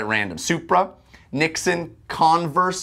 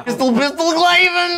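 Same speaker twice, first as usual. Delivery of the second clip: high, loud silly yell; 5 dB louder than usual. Pretend. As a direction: cry it out like nonsense. Nonsense yelling.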